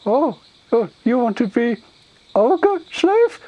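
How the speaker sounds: high, loud, rising ogre voice; simple-minded ogre, eager. Acted. Ogre misunderstanding the orc visitor, eagerly hopeful. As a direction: ask like a hopeful, dim ogre.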